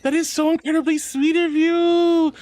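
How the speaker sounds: squealy gift-sub thank-you; excited thanks. Real, not acted. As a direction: gush over the gift.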